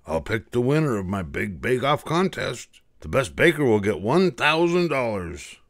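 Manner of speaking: lightly voiced showman chef; TV chef announcer. A narrator's reading. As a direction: announce the prize like a TV host.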